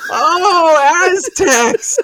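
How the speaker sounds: high, loud cry of "oh" breaking into laughter; hugely high-pitched, laughing exclamation; laughing realization. Retold or imitated. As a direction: relive the penny dropping, cracking up. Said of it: "I laughed for, like, ten minutes".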